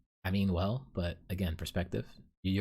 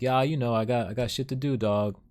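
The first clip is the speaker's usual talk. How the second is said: louder, offhand street voice; Ricardo's voice; 3 semitones higher, 4 dB louder than usual. Retold or imitated. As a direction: brush him off without looking back. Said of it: "I wanna mimic his voice".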